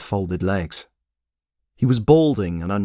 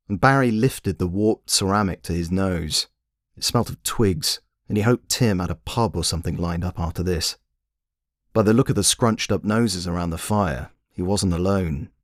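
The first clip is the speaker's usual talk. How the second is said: usual speaking voice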